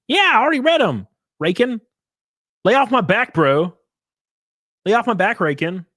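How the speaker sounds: high, loud exasperated snapping; whiny, defensive yell; yelling at chat. Real, not acted. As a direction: push back loudly, pitch up. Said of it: "this guy yelling at his chat".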